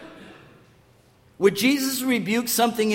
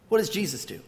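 normal talking voice

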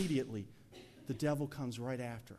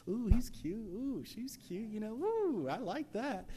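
high, sing-song mock admiration; playful swooning; 9 semitones higher, 4 dB louder than usual. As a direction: coo it with exaggerated interest. Mimicking singles checking each other out.

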